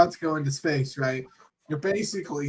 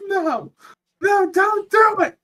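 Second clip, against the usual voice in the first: high, louder hammed-up pleading; mock pleading shout; 6 dB louder than usual. Astronauts begging the pilot not to pour, high mock shout. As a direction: yell it in a high panic.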